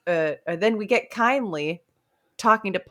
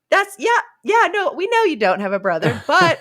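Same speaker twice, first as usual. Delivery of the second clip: high, loud mock placating; knowing humouring; 9 semitones higher, 6 dB louder than usual. Soldiers humouring Twit about his brother, exaggerated knowing reassurance. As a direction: play along loudly, pitched up at the start.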